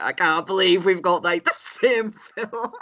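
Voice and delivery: excited, breaking into laughter; giddy disbelief. Real, not acted. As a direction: marvel at the coincidence, laughing at the end. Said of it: "I'm glitching 'cause I'm just like".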